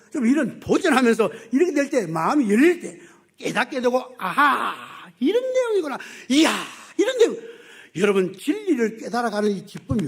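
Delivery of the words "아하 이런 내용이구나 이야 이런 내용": a believer's sudden joyful realization; delighted discovery. Pretend. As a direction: gasp with joy at a new insight. Voiced believer discovering truth.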